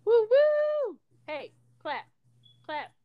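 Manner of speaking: ordinary talk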